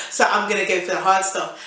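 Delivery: ordinary delivery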